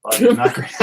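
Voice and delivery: laughing while speaking. Real, not acted. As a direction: say it through a laugh. Ruefully joking about the imaging.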